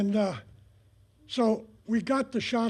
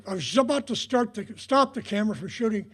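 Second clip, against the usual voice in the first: ordinary talk, nothing marked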